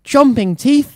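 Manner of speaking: high, breathless and scared; puffing. Acted. Fleeing farmers puffing the words, frightened. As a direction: puff it out in fright. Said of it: "they puffed".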